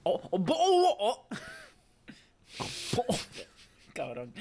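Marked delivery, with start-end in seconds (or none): 0.1-1.4 high-pitched glottal British "bottle of water"; exaggerated British meme voice, all glottal stops; mock British accent. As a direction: drop every T in a hammed-up British accent. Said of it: "say it with a British accent".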